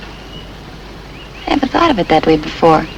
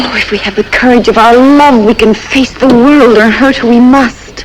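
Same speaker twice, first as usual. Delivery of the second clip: mock romantic; 5 semitones higher, 3 dB louder than usual. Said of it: "I had you fooled for a minute".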